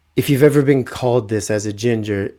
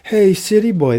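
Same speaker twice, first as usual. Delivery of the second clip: high-pitched mocking kid call; taunting schoolkids; 6 semitones higher than usual. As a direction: tease like kids in the schoolyard. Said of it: "they started off calling me city boy".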